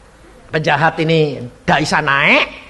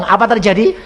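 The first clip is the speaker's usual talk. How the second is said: usual speaking voice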